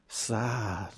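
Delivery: low, soft mournful sad. Shown as a demonstration. Leading the congregation in the sad sign, low and soft. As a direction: let the word sink low and quiet. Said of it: "adults, let's try it".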